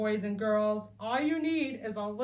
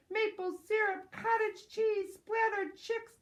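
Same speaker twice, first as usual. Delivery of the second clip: plain reading voice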